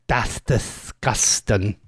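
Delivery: slow, low Norfolk "That's disgusting"; Norfolk accent. Shown as a demonstration. Host correcting a caller's Midlands attempt, slow Norfolk. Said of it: "Like that".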